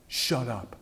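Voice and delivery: low, soft order to be silent; quiet command. Retold or imitated. Preacher gives Jesus's word to the spirit, quiet command. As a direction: shut it down without raising the voice. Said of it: "be quiet".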